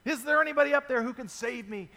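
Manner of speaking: a man on a branch shouting to the sky; crying out for help. Retold or imitated. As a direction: yell it while hanging off a cliff. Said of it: "he cries out".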